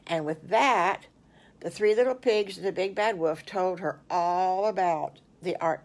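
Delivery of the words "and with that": usual speaking voice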